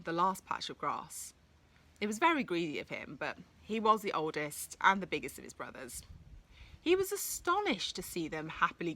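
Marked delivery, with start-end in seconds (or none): none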